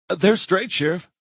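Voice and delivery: put-on a touch of a nervous young deputy. A narrator's reading. Young deputy voiced in story.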